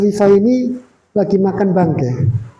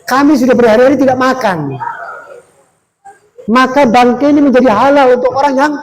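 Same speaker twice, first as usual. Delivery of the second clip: raised, louder plea of a starving widow; pleading widow; 4 semitones higher, 4 dB louder than usual. Acted. Widow pleads hunger to the scholar, raised earnest plea. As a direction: beg him to understand, voice lifted. Widow explains why she eats carrion.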